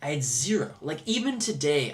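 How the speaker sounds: usual speaking voice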